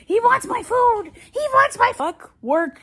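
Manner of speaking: high-pitched, loud squawk; excited yelling. Real, not acted. Telling viewers the dog wants food, high yelling. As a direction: yell it out high over the dog.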